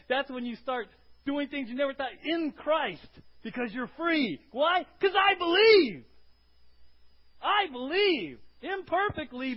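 loud, rising exhortation, slow on believe; full-force preaching. Acted. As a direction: preach it to the rafters. Preaching climax.

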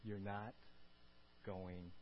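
low, soft, slow assurance; steady them with a hushed promise; quiet, deep calm with long pauses; reassuring promise. Acted. Voices God's calming promise.

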